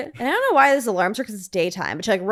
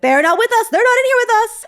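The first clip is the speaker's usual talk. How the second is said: higher and louder, mock yell through a window; retold yelling; 12 semitones higher, 7 dB louder than usual. Characters yelling at the window, higher, louder mock yell. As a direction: shout it as if through the glass. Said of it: "yelling at the window".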